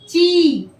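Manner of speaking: ची with a stretched long ii; drawn-out long ii. Shown as a demonstration. Teacher modelling ची for children. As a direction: pull the vowel of ची long. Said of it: "क्या होगा इसका उच्चारण".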